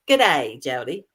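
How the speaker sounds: lightly imitated Australian greeting, dropping low; Australian accent. Co-host reading an Australian viewer's greeting, Australian accent. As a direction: hit the first words, Australian style, then drop away. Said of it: "she's Australian, so it says".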